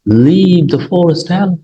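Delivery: teacher dictating the sentence, high and slow; slow example reading. Shown as a demonstration. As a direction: read it out slowly and clearly for learners.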